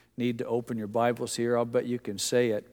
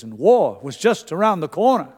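louder imitation of the preacher's accent, pitched up; Billy Graham; 4 semitones higher, 8 dB louder than usual. Pastor quoting a famous evangelist's warning on war, imitated accent. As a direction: put on the famous preacher's accent, swooping and emphatic. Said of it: "in that wonderful accent of his".

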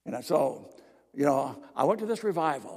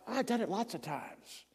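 softly, before the outburst; quiet plea; 5 semitones higher, 6 dB quieter than usual. Retold or imitated. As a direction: say it under your breath first. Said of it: "I yelled as loud as I could".

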